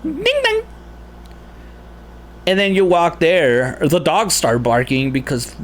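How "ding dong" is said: very high sung doorbell chime, ding dong. Retold or imitated.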